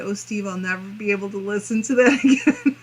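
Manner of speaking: quick words breaking into real laughter; laughing. Real, not acted. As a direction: read it fast while cracking up. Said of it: "I don't know why I'm laughing".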